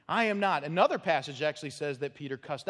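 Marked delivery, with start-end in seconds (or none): 0.1-0.6 Peter's denial. Retold or imitated. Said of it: "Peter denying".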